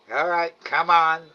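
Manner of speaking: mock a dad ordering his kid along; retold fatherly summons. Father collecting him from holding cell.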